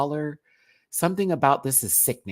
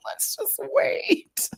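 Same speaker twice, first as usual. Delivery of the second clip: mock crying whisper; much slower than usual. Teasing viewers about the ranking, in a mock crying whisper. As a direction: whisper it like a mock sob, high and slow. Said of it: "That's me".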